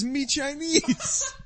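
slow, drawn-out "Me Chinese" with a rising end; mock broken English. Friend mimicking the prankster's intro, slow and drawn out. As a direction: lightly imitate the guy's broken English. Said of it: "he says".

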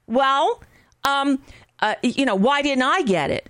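a hint of a petulant man asking why; the smoker on Facebook, mildly put-upon; emoting for him. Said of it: "the voice that I hear in my head".

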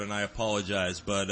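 ordinary talk, nothing marked

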